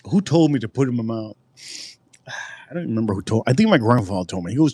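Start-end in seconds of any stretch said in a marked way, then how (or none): none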